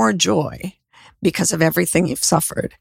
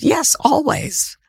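son's calm certainty; 9 semitones higher, slower than usual. Retold or imitated. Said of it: "And he said".

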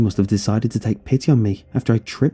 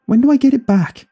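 high, loud plaintive question; indignant demand; 5 dB louder than usual. A narrator's reading. Son to mother taking his laptop away, indignant. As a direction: protest it with pitch way up.

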